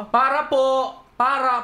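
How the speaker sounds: high, insistent retold call. Passenger ignored by the jeep driver, calling out insistently.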